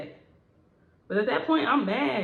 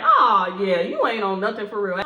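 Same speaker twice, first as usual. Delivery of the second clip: dismissive retort; 7 dB louder than usual. Retold or imitated. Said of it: "I'm like".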